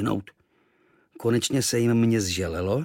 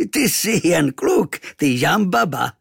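loud, higher, drawn-out foreign character; big comical islander voice; guffawing islander; 6 semitones higher, 6 dB louder, slower than usual. Acted. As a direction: exclaim it with slow, amused surprise. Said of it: "zamrkal a rozchechtal se".

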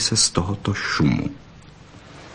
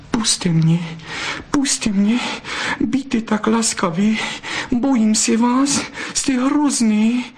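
much higher, panicky begging voice, slowing at the end; frightened pleading; 13 semitones higher, slower than usual. A narrator's reading. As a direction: beg him in rising fear to let go. Frightened vixen begs, rising.

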